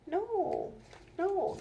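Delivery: ordinary delivery, nothing marked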